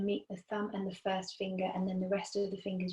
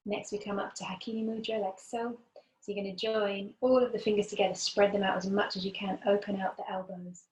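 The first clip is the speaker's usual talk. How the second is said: ordinary talk, nothing marked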